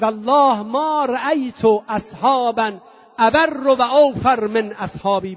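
slow, emphatic quoted oath; solemn Arabic recitation. Retold or imitated. Imam praising his loyal companions, slow solemn recitation. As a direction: intone it slowly and firmly.

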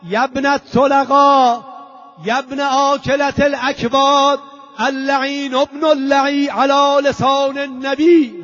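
loud, high, slow denunciation; declaimed shout of defiance; crying out. Acted. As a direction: hurl each phrase out, drawn out and ringing. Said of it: "فریاد زد".

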